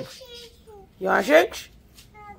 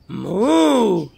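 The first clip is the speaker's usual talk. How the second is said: cow moo; 3 dB louder than usual. Shown as a demonstration. Demo cow sound for baby.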